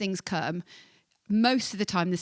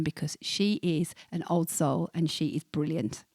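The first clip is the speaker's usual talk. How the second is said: ordinary delivery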